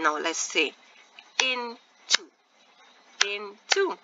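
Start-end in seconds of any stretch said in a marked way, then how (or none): 1.4-2.2 INTO broken in two, IN ... TO; split into syllables. Shown as a demonstration. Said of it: "See, there's a split there". 3.3-4.0 INTO said in two beats, IN ... TO; split into syllables. Shown as a demonstration. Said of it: "See, there's a split there".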